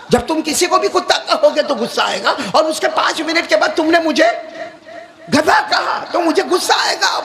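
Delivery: high, insistent indignant tirade; acted anger. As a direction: protest like the insulted hakim, pitch climbing. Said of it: "ابو علی سینا تو غصے میں تھے".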